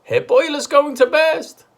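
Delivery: high, loud wail of panic; panicked wail. A narrator's reading. Missus Cranky panicking over Grandma, high and loud. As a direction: wail it in fright.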